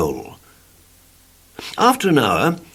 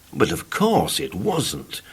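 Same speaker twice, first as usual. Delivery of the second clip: usual speaking voice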